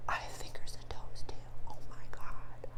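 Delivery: whispered awe. Retold or imitated. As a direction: breathe it out in quiet wonder. Another picnicker answers.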